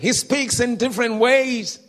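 emphatic, projected declaration; loud preaching. Retold or imitated. Preacher teaching how God speaks, loudly. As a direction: project it firmly to the back rows. Retells preacher addressing the crowd.

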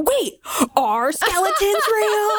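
high, slow, stretched-out disbelief; squeaky-high baffled double take; mock incredulity. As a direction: imitate someone suddenly doubting everything. Acting out Aziz's dawning disbelief.

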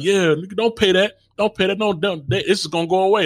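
breezy high careless friend voice; egging him on, carefree and high. Retold or imitated. Friend urging him to skip the bill, breezy and high. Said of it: "somebody was like".

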